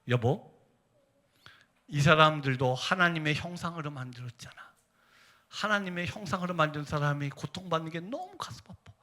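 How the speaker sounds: imitating a hushed, heartfelt spouse; wife's gentle reply. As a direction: answer the anger with quiet compassion. Voices wife's gentle, pained reply.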